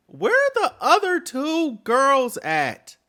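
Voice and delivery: high, loud, slow demand; outraged shout. Real, not acted. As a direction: yell the question in outrage. Outraged at the father.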